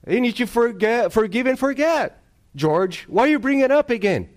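high, loud, scolding quote; mock lecturing tone, pitched high and loud; dismissive scolding voice. Quoting someone dismissing a complaint.

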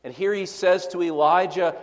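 usual speaking voice

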